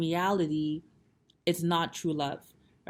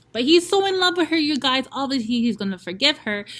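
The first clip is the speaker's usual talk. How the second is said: much higher, louder, quick gush; excited gush; 8 semitones higher, 8 dB louder than usual. Real, not acted. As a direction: blurt it out, thrilled for him. Excited aside to viewers.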